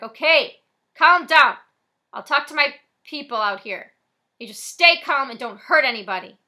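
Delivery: loud, placating shout; cop yelling back. Acted. Cop yells back to Frosty, loud. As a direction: shout to calm the robber down. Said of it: "The cop yells back".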